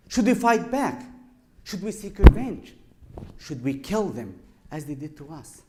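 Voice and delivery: a son pushing his father for revenge; angry son. Retold or imitated. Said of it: "So his kid was so angry".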